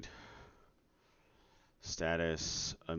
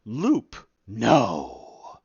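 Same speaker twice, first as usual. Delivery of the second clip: loud high mock Loop, flat loud No; sarcastic self-answer; mock question and answer; 3 semitones higher, 11 dB louder than usual. Dev mocking the fake loop option, sarcastic.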